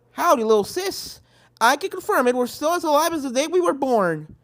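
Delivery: country sister. Acted. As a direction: reassure little sis heartily. Applejack speaks to her sister.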